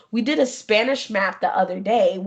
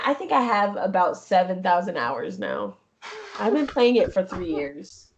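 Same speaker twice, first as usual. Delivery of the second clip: ordinary talk, nothing marked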